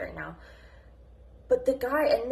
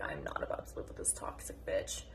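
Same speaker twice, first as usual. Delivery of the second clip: bitter whisper; 8 dB quieter than usual. Retold or imitated. Retells leaving toxic mother.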